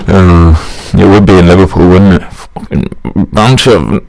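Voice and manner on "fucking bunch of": slow, halting attempt at Scouse; badly faked Scouse, drawn out; botched Scouse accent. Presenter jokes about Liverpool, failed Scouse accent. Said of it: "trying to do a Scouse accent".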